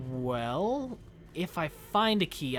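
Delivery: usual speaking voice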